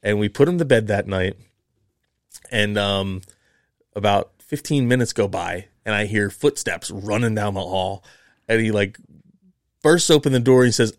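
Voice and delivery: ordinary talk, nothing marked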